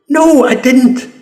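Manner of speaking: high, drawn-out indignant refusal; emphatic denial. Real, not acted. Speaker rejecting the idea of complaining, indignant denial. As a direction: push it high and slow, outraged. Angrily answers his own rhetorical question.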